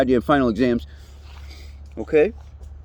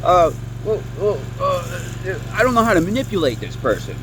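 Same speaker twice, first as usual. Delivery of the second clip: flustered manipulator; 5 semitones higher than usual. Retold or imitated. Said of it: "They're gonna be like".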